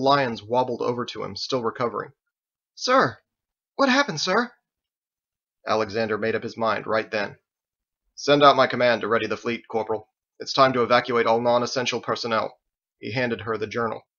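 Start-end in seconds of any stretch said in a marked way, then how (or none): 2.7-4.5 put-on high, urgent young soldier calling out; high-pitched startled subordinate; startled corporal. Startled corporal addresses commander. 8.1-12.6 commanding officer. Acted. Commander issues evacuation orders.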